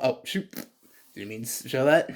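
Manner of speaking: ordinary delivery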